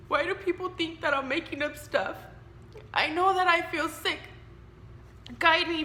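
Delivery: fighting back tears while praying to God; getting emotional. Real, not acted. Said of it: "I'm gonna get emotional".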